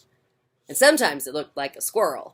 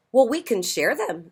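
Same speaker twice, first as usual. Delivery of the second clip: higher sweet offer; friendly ladybug; 7 semitones higher, 5 dB louder than usual. Acted. Friendly ladybug offering to share, sweet and high. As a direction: offer kindly, light and high.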